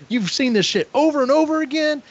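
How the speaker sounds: high, indignant retold complaint; berating. Tyler berates Todd for repeating himself, high indignant scolding. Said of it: "he is berating him constantly".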